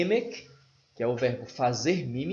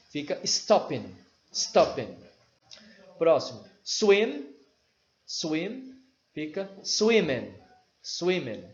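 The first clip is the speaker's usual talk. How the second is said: ordinary talk, nothing marked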